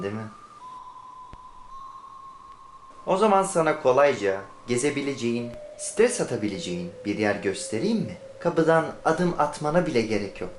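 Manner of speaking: smooth selling ad read, music under it. Acted. Ad voice selling a holiday to tired workers. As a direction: pitch a holiday like a TV commercial.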